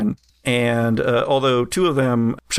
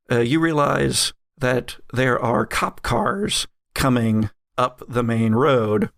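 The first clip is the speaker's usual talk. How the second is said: normal talking voice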